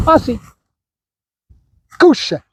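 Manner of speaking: dog command. Real, not acted.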